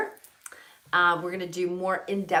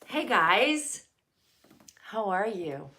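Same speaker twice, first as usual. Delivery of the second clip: ordinary talk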